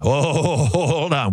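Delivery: a chuckling mock double take; amused mock warning; mock alarm. Mocking reaction again.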